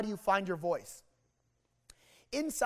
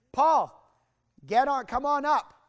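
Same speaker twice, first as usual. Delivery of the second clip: louder and higher, calling a student up; teacher calling out; 5 semitones higher, 6 dB louder than usual. Retold or imitated. Teacher calling Paul up to present, loud call. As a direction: summon him to the front.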